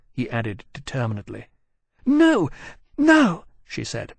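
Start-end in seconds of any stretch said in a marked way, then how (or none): 2.1-3.5 high, panting out of breath. Acted. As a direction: gasp it out, winded. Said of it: "panting as she came up to them".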